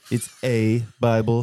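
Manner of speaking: lightly imitated presidential reply, key word leaned on; Donald Trump. President correcting a news reporter, light impression. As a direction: repeat the quip as an impression. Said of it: "you doing the Trump impression".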